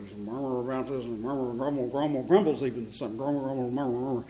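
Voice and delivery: low, soft grumbling mumble; murmuring. Shown as a demonstration. Preacher showing how murmuring sounds like grumbling, low murmur. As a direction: run the words together under your breath. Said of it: "murmuring sounds exactly like that".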